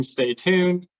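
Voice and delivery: ordinary talk, nothing marked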